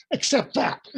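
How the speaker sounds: high-pitched, quick mock urging; excited urging. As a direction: retell it as an excited high exclamation. Retold urging at big invitation.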